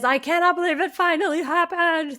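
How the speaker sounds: high, gushing read of a fan letter; exclamation-heavy excitement. Retold or imitated. Host reads winner's happy message aloud, high excited gushing. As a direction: gush it high, every phrase exclaimed. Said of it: "So many exclamation".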